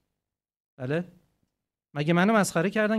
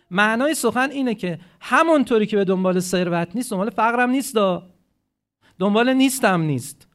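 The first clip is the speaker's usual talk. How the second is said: loud, emphatic sermon voice; preaching at full force; 5 semitones higher, 7 dB louder than usual. Acted. Preacher to congregation, loud and emphatic. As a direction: drive the point home to the congregation.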